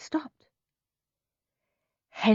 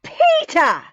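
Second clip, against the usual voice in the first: loud, higher furious shout of a name; yelling; 11 semitones higher, 7 dB louder than usual. A narrator's reading. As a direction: yell his name in anger. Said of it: "yelled Mum and Dad".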